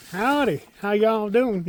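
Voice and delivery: high, loud Southern drawl greeting; hammed-up Texan howdy, Southern drawl. Imitating the foreign hiker's Southern drawl. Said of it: "a drawl going on".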